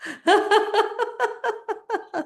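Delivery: long high laugh; exercise laughter. Shown as a demonstration. Drinking it up while laughing, long high laughter. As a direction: keep a high laugh rolling, falling at the end.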